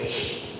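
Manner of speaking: soft, voiceless "shh"; hushing shush. Acted. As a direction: silence someone with a long shush. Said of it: "el Señor le dijo".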